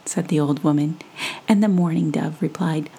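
ordinary delivery, nothing marked

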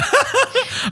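high squealing laugh breaking out; burst of laughter. Real, not acted. Host laughing at sneaking into the book signing. Laughing at getting into free event.

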